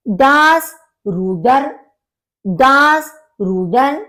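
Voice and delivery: Das Rudern very slowly, syllable by syllable; slow split-up words. Shown as a demonstration. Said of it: "अलग-अलग divide करके बोलना चाहिए". (Das Rudern को धीरे-धीरे तोड़कर)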